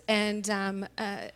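ordinary talk, nothing marked

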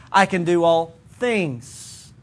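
"things" held long after a pause; stress on THINGS. Shown as a demonstration. Preacher demonstrating varied emphasis, stress on "things". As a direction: save the weight for "things", slowly. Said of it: "A different emphasis would be".

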